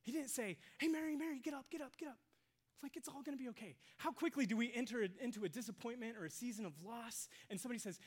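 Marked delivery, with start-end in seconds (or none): 0.8-3.8 high, quick mock pep talk; pushy cheering-up. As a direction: hurry a mourner along, pitched up. Acts out brusque cheering-up Jesus avoided.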